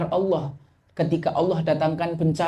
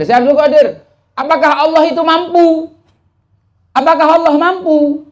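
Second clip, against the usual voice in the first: put-on much higher, loud insistent drunk's question; drunkard pleading; 11 semitones higher, 8 dB louder than usual. The drunkard presses the sheikh with his question, loud and very high. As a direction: press the question on him like a tipsy man. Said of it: "Ada Syekh Abdul Qadir".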